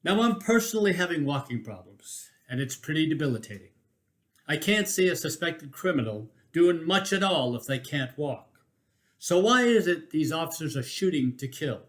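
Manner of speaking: ordinary delivery, nothing marked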